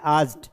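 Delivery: आस्क्ड as a single syllable, "askt"; correct pronunciation. Shown as a demonstration. Teacher answers own question for students, आस्क्ड said correctly as one syllable. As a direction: run the ending of आस्क्ड straight into a t. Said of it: "क्या पढ़ना है?".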